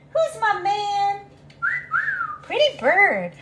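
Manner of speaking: high, slow, gushing bird praise; lavish the bird with high sweet praise; pet talk. Real, not acted. Praising bird doing tricks.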